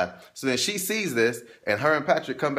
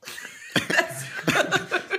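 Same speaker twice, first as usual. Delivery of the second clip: breathy laugh. Real, not acted. Laughing before sign-off.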